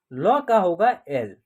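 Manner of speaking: ordinary talk, nothing marked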